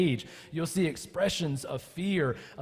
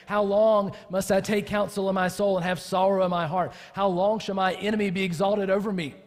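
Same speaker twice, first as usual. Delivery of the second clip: raised, louder plea read from the psalm; impassioned psalm reading; 5 semitones higher, 6 dB louder than usual. Acted. Pastor reading the psalmist's cry aloud, impassioned.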